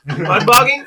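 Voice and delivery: twangy Southern drawl on mud bogging; Southern accent. Real, not acted. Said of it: "your Southern accent is kicked in for that entire sentence".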